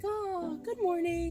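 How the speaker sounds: hammed-up cheery hallway greeting; 1 sweet teacher greeting. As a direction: greet a small child brightly. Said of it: "and I'm like".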